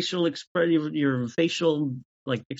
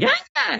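very high excited squeal; sudden high-pitched cry of delight; Charlie's yelp. Acted. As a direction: squeak it out like a giddy character. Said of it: "But Charlie became like".

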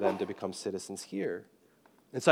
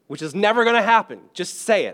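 high, loud exasperated push; hammed-up insistence, pitch up and louder; imitating a pushy officer; mock exasperated official; 10 semitones higher, 8 dB louder than usual. Retold immigration guy exasperated.

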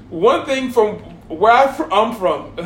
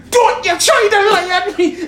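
higher, louder furious shout at her son; angry mother yell; 9 semitones higher, 3 dB louder than usual. Retold or imitated. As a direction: blast the lie out of him. Angry mother catches son lying.

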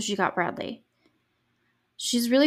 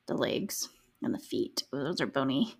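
whispered and lower, wincing; grossed-out whisper; 7 semitones lower, 7 dB quieter than usual. Real, not acted. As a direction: hush it, cringing at bony legs. Wincing at the stab wounds.

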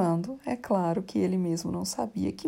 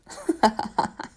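hysterical laugh; 6 semitones higher, 4 dB louder than usual. Real, not acted. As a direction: burst of helpless laughter. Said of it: "desculpa a crise de riso".

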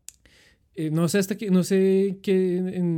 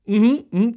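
nodding ujú; 6 semitones higher, 8 dB louder than usual. Retold or imitated. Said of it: "digamos como".

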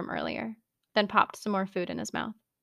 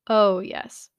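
ordinary delivery, nothing marked

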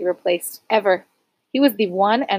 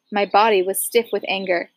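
usual speaking voice

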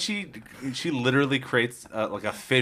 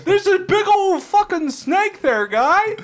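panicked villagers; 8 dB louder, much slower than usual. Retold or imitated.

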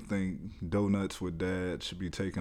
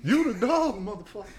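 joking yell; 8 dB louder than usual. Real, not acted. Mock insult yelled at friend.

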